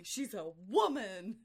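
slow, loud, hammed-up reveal; mock dramatic reveal. Mocking the film's hair-tumbling reveal, hammed-up drama. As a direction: stretch it out as a mock big reveal.

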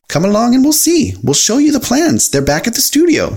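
high, friendly cartoon voice; Mickey Mouse, inviting. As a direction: coax them along in a high voice.

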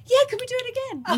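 high, fast, thrilled; eager excitement. Retold or imitated. Pulled into the helicopter, asking the crew, thrilled excitement. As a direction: ask it bursting with glee. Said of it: "I went".

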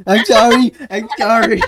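loud, high, laughing apology; flustered apology. Real, not acted. Host apologizing for the stray mouse, loud and flustered. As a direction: blurt it out, mortified and amused.

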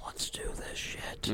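hushed whisper to kick things off. Real, not acted. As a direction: breathe it out, no voice. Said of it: "whispered kickoff".

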